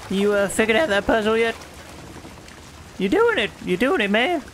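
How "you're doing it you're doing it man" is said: high, encouraging cheer; excited cheering. Real, not acted. Friend watches Tom at the puzzle, excited cheering. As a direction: cheer them on brightly as they manage it.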